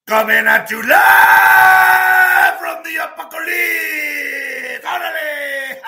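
high, slow stretched ring announcer shout; lucha libre announcer. Acted. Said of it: "without further ado, ladies and gentlemen".

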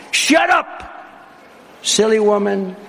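sudden high mock snarl as the snake; a hammed-up snake snapping; snake with a grin. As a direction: sneer it as the grinning snake. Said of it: "said the reptile with a grin".

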